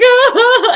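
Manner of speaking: high laughing mock sob; imitated hysterical cry. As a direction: wail it high through laughter. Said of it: "she's just like".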